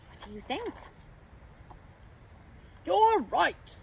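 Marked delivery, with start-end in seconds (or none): 2.8-3.8 hearty agreement; Santa. A narrator's reading. Santa approving the pouch idea, hearty. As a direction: agree in a full character voice. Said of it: "said Santa".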